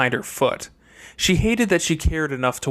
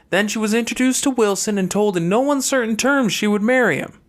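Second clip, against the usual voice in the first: higher sister's voice, cool and matter-of-fact; a younger sister laying out hard truths, voiced higher; Fernanda's voice; 8 semitones higher than usual. Fernanda explains her place to brother Chase, higher sister voice.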